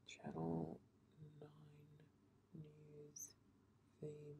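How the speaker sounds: hushed whispered title; soft whispered announcement. Real, not acted. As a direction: whisper each word like a secret title.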